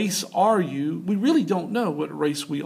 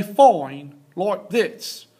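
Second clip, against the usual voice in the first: drawn-out, louder Australian "fine"; broad Australian vowels, higher and slower; Australian accent; 4 semitones higher, 4 dB louder, much slower than usual. Shown as a demonstration. Teacher showing students how accent changes a word, Australian accent. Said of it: "a little more Australian accent".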